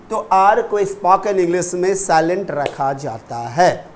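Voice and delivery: ordinary talk, nothing marked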